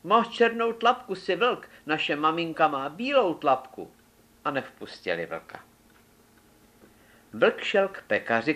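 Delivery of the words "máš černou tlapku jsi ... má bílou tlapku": put-on much higher child voices calling out; kids calling out. A narrator's reading. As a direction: squeal it like little kid goats.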